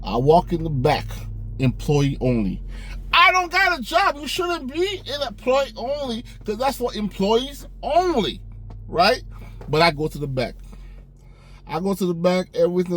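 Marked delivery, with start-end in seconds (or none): 3.1-9.2 squeaky high, playing dumb about the sign; high goofy voice talking himself into it; high mock-innocent voice. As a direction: pitch it way up like a clueless kid. Mock-naive inner voice at employee door.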